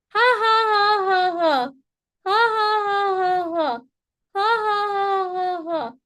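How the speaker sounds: high, falling staged laugh; rhythmic ha's running down on one breath; exercise laugh. Shown as a demonstration. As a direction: start high and let the laugh slide down. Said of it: "number two is breathing".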